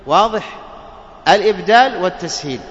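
usual speaking voice

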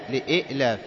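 لإئلاف with a glottal stop before "laaf"; "li-i'-laaf" for لإئلاف, second hamza sounded; original two-hamza form. Shown as a demonstration. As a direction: catch the breath mid-word in لإئلاف. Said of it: "أصلها إيش؟".

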